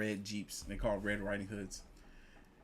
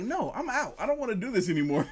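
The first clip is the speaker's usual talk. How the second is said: higher, louder, fast backing out; mock panic; 9 semitones higher, 4 dB louder than usual. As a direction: bail out in a rush. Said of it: "I'm like".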